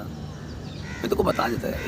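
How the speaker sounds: a hushed, slow aside under his breath; whispered, slow, almost to himself. Real, not acted. Muttered aside mid rant.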